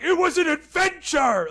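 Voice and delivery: very high, excited mock cheer; hammed-up thrilled shout; gleeful high exclamation. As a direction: squeal it out like a proud kid. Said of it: "he was like".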